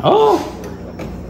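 a sudden high squeak of delight; delighted squeal. Real, not acted. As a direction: squeal it out, pleasantly surprised.

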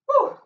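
short, high, loud woo; quick high whoop of effort; exertion whoop. Real, not acted. Trainer done with the exercise, high whoop.

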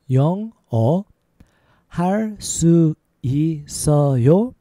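every syllable held and set apart; slow demonstration. Shown as a demonstration. Teacher showing learners how to ask about English, slow. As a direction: spell it out syllable by syllable. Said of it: "A little faster".

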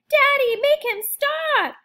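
high, loud, slow pleading yell; chicks yelling. Acted. Frightened chicks call their father against the hummingbird, high loud yell. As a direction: cry for daddy's help. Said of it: "yell the chicks".

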